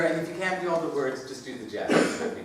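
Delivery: ordinary talk, nothing marked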